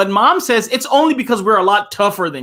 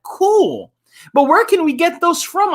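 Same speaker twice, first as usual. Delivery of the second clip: put-on excited kid; 12 semitones higher, 3 dB louder than usual. Kid character excited line.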